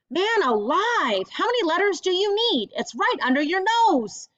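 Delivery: exasperated at the TV. Acted. Grammy urging Spencer on the TV, exasperated. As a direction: coach the contestant impatiently. Grammy scolds TV game contestant.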